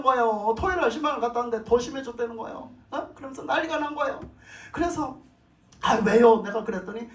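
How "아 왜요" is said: alarmed question. Retold or imitated. Alarmed reply on phone.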